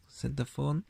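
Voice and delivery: usual speaking voice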